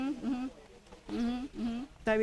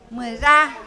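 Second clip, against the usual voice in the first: "Muera" punched on the final RA, louder; stress on second syllable; 5 dB louder than usual. Shown as a demonstration. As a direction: hit the last syllable of "Muera" hard. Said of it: "¿Dónde viene el acento de pronunciación?".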